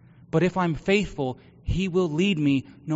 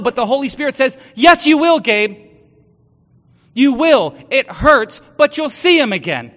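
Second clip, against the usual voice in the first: loud, higher, impassioned sermon voice; preaching; 5 semitones higher, 10 dB louder than usual. Acted. Pastor telling congregation about grief and hope, preaching at full force.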